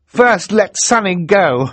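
loud, high, urgent demand; burst out. Acted. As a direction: blurt it out loud. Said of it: "Klaus burst out".

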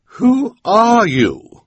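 high, loud, drawn-out demand; haughty challenge. A narrator's reading. Esme confronting a masked intruder, haughty challenge. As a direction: challenge the stranger, stretching every word.

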